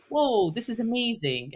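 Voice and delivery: normal talking voice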